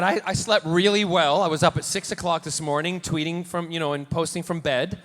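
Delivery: usual speaking voice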